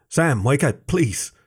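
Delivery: ordinary delivery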